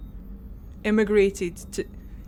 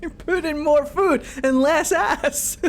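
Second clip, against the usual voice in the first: laughing mimicry; 7 semitones higher than usual. Repeats the game line mockingly.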